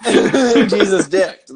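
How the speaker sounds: slow, loud, through laughter; laughing hard. Real, not acted. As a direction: drag it out while cracking up.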